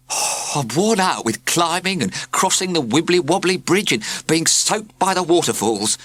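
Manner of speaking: high, whining complaint; lamenting postman. A narrator's reading. Weary postman complains to the class, high lament. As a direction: moan about the climb. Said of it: "he lamented".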